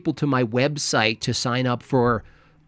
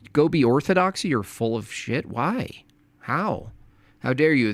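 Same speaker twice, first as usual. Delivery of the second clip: ordinary talk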